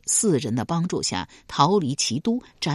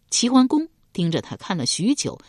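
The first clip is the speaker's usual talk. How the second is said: plain reading voice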